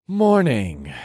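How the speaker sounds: drawn-out morning with a falling sigh; sighed greeting. A narrator's reading. Hungover hero answering the lieutenant's greeting, sighing. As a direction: let the greeting sag downward on a sigh. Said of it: "I sighed".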